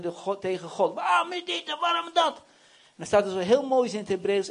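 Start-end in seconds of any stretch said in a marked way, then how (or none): 0.9-2.4 high-pitched put-on griping; hammed-up whiny protest; mock complaining. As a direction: wail it like a caricature of a moaner. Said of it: "dan loopt hij te klagen".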